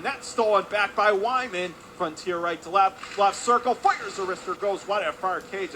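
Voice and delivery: punchy, lifted sports commentary; excited play-by-play. Real, not acted. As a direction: build urgency towards the shot. Turnover leads to a shot.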